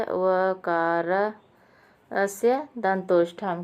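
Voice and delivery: वकारस्य दंतोष्ठम drawn out syllable by syllable; deliberate chant-like reading of वकारस्य दंतोष्ठम; slow recitation. Shown as a demonstration. Reciting the sutra slowly.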